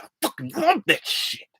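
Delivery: high-pitched passionate outburst; hyped-up declaration. Acted. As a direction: act out waking up fired up. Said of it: "wake up in the morning and say".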